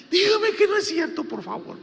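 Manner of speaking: high and loud, imitating a sobbing sister; desperate crying plea. Sister begs her pastor to deny it, high imitated wail. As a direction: cry out the plea, then drop. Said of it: "ella lloraba".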